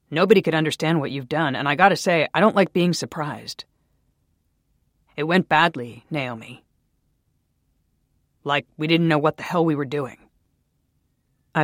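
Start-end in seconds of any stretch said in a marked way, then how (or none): 0.1-10.2 louder, firm reproach; stern scolding. A narrator's reading. Daniel blaming Naomi, louder stern reproach. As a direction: scold her for the failed iteration.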